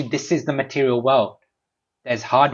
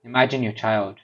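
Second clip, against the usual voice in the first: ordinary talk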